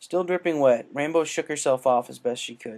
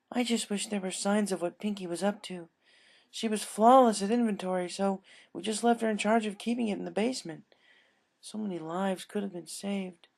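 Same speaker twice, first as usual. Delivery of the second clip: higher voice, fading sadly; a mild touch of grief, pitched up; Missus Cake, regretful; 9 semitones higher than usual. Acted. Baker grieves.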